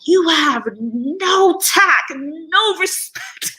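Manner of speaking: hammed-up elder telling off a rude man; older Ghanaian man scolding. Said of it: "was like".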